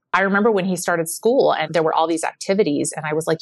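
ordinary delivery

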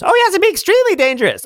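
high cackling witch greeting. Acted. GM as the Dimensional Witch, high and gleeful.

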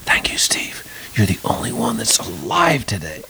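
hushed, unvoiced whisper; imitating a director's private whisper. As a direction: whisper it as if in confidence. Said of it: "comes up and whispers to me".

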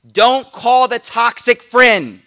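loud, slow and emphatic; emphatic warning. Acted. Preacher warning his listeners, loud and slow. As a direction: hammer each word home as a warning. Warning against calling toxic friends.

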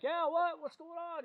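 high, soft faraway reply; high call back. Retold or imitated. Officer answering a passer-by from a traffic stop, high soft call. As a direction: call back high without full volume.

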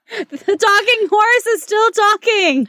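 laughing high in disbelief. Real, not acted. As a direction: giggle through the words.

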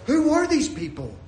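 high-pitched mock disbelief; incredulous readers. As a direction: exclaim in baffled disbelief, falling at the end. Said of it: "we're all reading this story and we're going".

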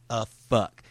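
normal talking voice

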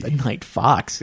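sultry radio voice. Pretend. Echoing the late night DJ.

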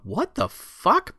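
high, slow drawn-out disbelief; reliving confusion in the theater; baffled. Retold or imitated. Moviegoer baffled by the scene, slow high disbelief. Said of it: "I remember this from the theater going".

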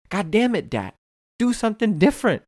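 high, loud mock rant; exasperated scolding. Comedians scolding a fellow comic, loud mock exasperation.

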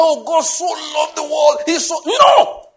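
high, loud mock sentimental gush. Preacher mocking a sentimental reading, high hammed-up gush. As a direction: ham up a sappy believer. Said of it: "It's not".

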